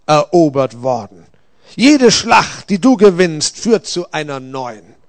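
forceful rebuke swelling loud, then settling; stern Gabriel, pronouncing judgement. A narrator's reading. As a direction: drive the accusation home.

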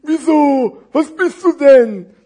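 high, loud, drawn-out question; exaggerated storybook puzzlement; curious big parcel. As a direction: stretch the words like a puppet character. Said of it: "fragte das dicke Päckchen".

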